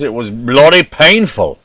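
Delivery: mock British accent, lightly done; a hammed-up British accent on bloody painful. American podcaster doing a hammed-up British accent for UK listeners. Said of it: "this poor attempt at your, uh, vernacular".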